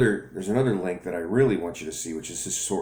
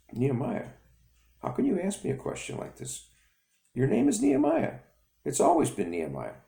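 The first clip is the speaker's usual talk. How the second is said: surprised father; 10 semitones higher than usual. Acted.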